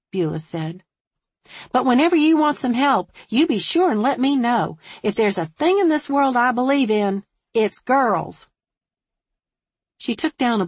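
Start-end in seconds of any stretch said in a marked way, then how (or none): 1.7-8.5 high, loud, emphatic Southern hairdresser; fervent hairdresser. A narrator's reading. Hairdresser offering help with the troop, fervent. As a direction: declare it with rising conviction.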